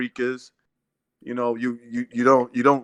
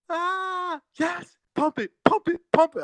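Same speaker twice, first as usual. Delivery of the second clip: screaming woman fan; slower than usual. Retold or imitated. Ex-sister-in-law cheering Holyfield, ecstatic high squeal. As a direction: squeal like she's climaxing. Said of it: "She's screaming like this".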